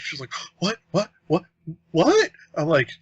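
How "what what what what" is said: rising mock panic, the last What? flying up high; imitating a flustered coworker losing it; panicked coworker. Retelling how the coworker panicked over calling the cops, mock frantic. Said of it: "she got all panicked".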